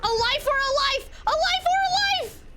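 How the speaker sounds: high, thrilled shriek; shrieking jackpot winner. Real, not acted. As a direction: scream it like you just won big. Chase, teammate saved, excited screaming.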